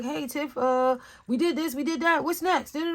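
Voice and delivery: high, chirpy mock check-in; imitated eager teammates. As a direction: mimic keen coworkers reporting in. Imitating friendly, cooperative team members.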